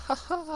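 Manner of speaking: high-pitched jeering "ha, ha, ha"; retold laugh at a fallen child; mocking laugh. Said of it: "I was like".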